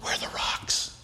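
hushed, breathy mock aside; mock whisper. Preacher whispering the angry townsfolk's line. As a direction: whisper it like a conspirator in the crowd. Mock crowd hunting for rocks.

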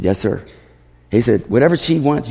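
ordinary delivery

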